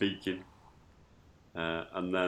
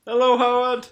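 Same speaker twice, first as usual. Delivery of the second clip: excited greeting; 11 dB louder than usual. Real, not acted. Greeting newcomer who walked in.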